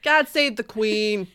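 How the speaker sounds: put-on loud, drawn-out Boston-accented proclamation; mock New England accent. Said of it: "Love a British accent".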